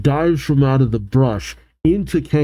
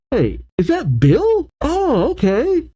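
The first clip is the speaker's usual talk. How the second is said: real surprise, high and lilting; pleasant surprise; 14 semitones higher than usual. Real, not acted. Reader spots a familiar character.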